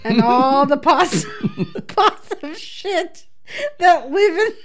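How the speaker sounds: reading aloud while breaking into giggles; can barely get the words out for laughing; laughing through the reading. Real, not acted. Reading the silly Mad Libs result to the listeners, cracking up.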